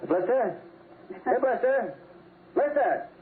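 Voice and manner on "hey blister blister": high, carrying calls for the dog; calling the dog. Acted. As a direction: shout across the yard for the dog.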